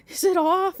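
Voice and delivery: wife yelling to her husband in the house, high and loud. Retold or imitated. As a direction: call it out across the yard. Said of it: "and I yell".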